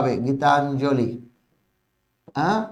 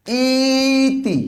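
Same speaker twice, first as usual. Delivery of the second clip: ইতি held very long and pitched higher; stretched demo word; 7 semitones higher, much slower than usual. Shown as a demonstration. Teacher pointing at a word's spelling, long and high. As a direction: draw ইতি out high for the board. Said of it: "এই যে".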